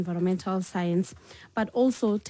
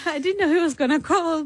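laughing; 8 semitones higher, 6 dB louder than usual. Real, not acted. Guest laughs about surprise call.

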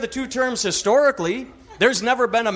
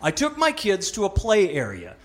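normal talking voice

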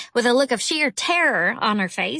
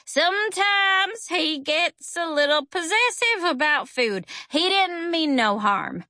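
slow, higher-pitched, drawling excuse; apologetic character; 5 semitones higher than usual. A narrator's reading. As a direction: excuse your pet slowly and meekly. Boy excuses his parrot, slow character voice.

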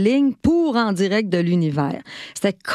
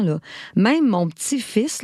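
usual speaking voice

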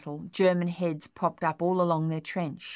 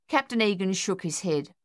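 ordinary delivery, nothing marked

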